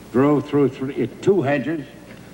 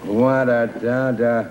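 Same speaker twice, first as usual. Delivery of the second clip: normal talking voice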